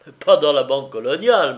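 loud, surprised exclamation; exclaiming. A narrator's reading. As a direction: cry out in disbelief. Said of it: "m'écriai-je".

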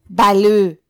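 loud, forceful order; explosive commanding shout. A narrator's reading. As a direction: yell the order at him. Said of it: "cria le commissaire".

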